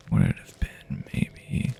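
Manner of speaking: ordinary delivery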